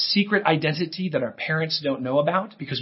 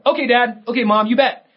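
put-on cheerful kid; 6 semitones higher, 3 dB louder than usual. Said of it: "in a cheerful way".

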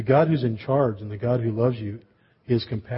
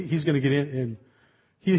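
normal talking voice

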